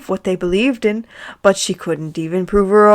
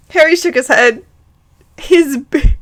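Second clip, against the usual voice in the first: ordinary delivery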